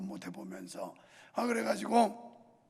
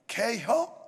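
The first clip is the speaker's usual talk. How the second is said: Chungcheong dialect; 6 dB louder, much slower than usual. Retold or imitated. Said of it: "충청도 사람들은".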